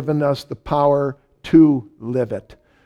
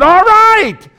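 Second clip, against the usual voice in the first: much higher, loud yelled all right; 11 dB louder than usual. Retold or imitated. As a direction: yell it to make the voice stop. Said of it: "I just yelled it out".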